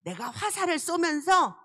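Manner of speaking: ordinary talk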